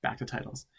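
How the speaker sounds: ordinary delivery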